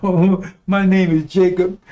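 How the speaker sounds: slow, put-on sappy voice; mocking voice. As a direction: parody a convert announcing his name. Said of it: "why you right here talking about".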